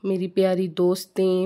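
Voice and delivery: ordinary talk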